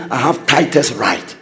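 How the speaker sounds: voicing a tither. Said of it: "you say".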